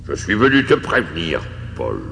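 solemn spectral warning; grim ghost. Acted. Ghost warns Paul of three spirits to come, solemn. Ghost figure speaking in story.

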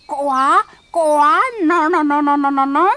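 high, loud croaking frog; funny frog voice. Acted. Frog interrupts the prince, funny croaking voice. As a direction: croak out frantic denials. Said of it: "une drôle de voix".

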